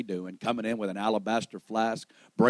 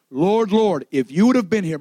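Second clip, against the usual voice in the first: raised, louder plea as Mary; imploring cry; 7 semitones higher, 7 dB louder than usual. Acted. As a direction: call out to the Lord, pleading. Acting out Mary repeating Martha's plea.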